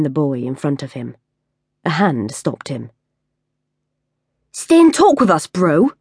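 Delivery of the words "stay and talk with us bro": put-on high, loud street-kid voice, urging the group; a young tough pressing him, playing to his pals; cocky kid calling out to the crowd. A narrator's reading. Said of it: "the boy urged in a voice that spoke to the others as well".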